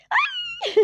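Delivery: high delighted shriek; a sharp high yelp of delight; joyful squeal. Acted. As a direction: squeal with sudden joy. Wife squeals at pregnancy news.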